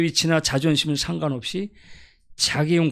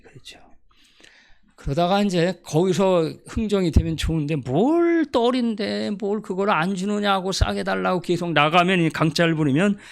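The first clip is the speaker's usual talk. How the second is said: ordinary delivery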